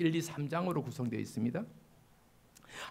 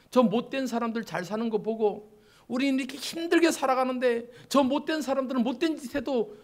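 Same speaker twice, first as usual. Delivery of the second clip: high, raised voice protesting unfairness; aggrieved complaint; 7 semitones higher, 6 dB louder than usual. Acted. Believers complaining to God that the wicked live well, aggrieved protest. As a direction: complain upward as the hard-done-by believer. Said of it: "라고 막 하기 쉽잖아요".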